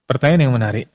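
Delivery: normal talking voice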